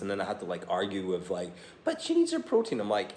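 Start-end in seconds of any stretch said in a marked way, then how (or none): 1.8-2.8 much higher, prissy mock dietitian voice. Dad mimicking the dietitian who insists on protein. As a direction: squeak it like a fussy dietitian.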